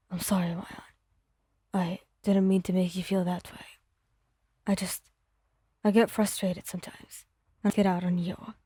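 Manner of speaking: low, hushed apology, starting in a whisper; remorseful Bakugo. Acted. Bakugo apologizing to Wyon, low and hushed.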